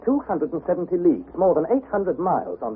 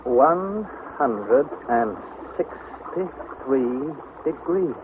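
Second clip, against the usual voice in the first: slow reading; much slower than usual. Acted. Nephew reading the thermometer, awed.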